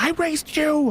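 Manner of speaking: retold in a high-pitched indignant voice; offended mother. Mother answering her son's question about his dad, offended. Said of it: "she got really offended".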